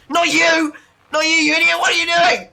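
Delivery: high, loud retold panic yell; panicked shout. Retelling a teammate's reaction to a bad pull, panicked shout. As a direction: yell it in full mock panic. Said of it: "It was, like, full panic".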